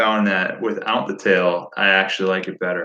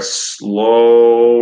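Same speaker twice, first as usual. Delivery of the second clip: SLOW stretched long and louder; drawn-out SLOW; 6 dB louder than usual. Shown as a demonstration. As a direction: draw out the vowel in SLOW. Describes a slow retrieve.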